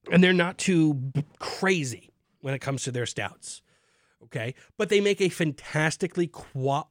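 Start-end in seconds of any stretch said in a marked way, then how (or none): none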